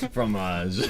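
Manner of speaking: hammy French word, far voice; mock French accent. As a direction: say it like a fake Frenchman. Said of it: "we're just doing French isms".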